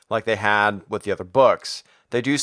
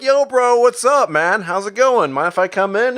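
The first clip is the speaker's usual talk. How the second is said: high, loud buddy-buddy hello; chummy intruder; 9 semitones higher, 4 dB louder than usual. Retold or imitated. Miles tapping on Peter's window, acted high and chummy. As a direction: pitch it up, over-familiar and eager.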